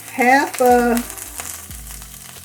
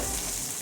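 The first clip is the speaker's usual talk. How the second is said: throat clearing; 16 dB quieter than usual. Real, not acted. Cough lingering for two months.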